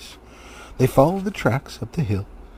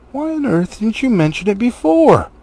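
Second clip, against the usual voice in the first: much higher and louder outburst; exasperated cry; 11 semitones higher, 8 dB louder than usual. Acted. Dwarves crying out at Bilbo over the key. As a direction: throw up your hands. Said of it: "they cried".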